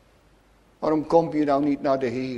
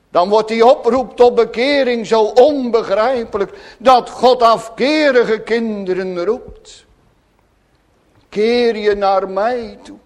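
loud, impassioned pulpit voice; fervent preaching; 7 semitones higher, 9 dB louder than usual. Acted. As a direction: preach it out with full conviction. Preacher in full flow. (vurig preken, luid en hartstochtelijk)

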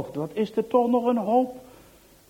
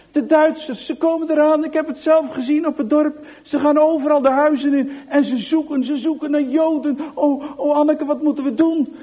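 high, loud breathless alarm; frightened warning; 7 semitones higher, 7 dB louder than usual. Acted. Piet warning Anneke the Germans are coming, high panic. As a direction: raise the alarm in terror.

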